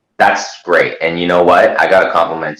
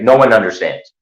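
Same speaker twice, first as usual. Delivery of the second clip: usual speaking voice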